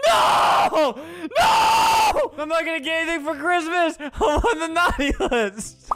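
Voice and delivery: hammed-up high wailing; mock despair. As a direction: fake a tantrum, crying out high. Mock despair at landing on naughty list.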